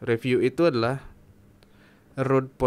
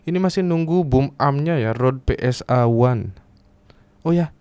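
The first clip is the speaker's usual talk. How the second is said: ordinary talk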